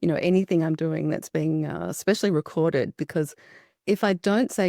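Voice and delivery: usual speaking voice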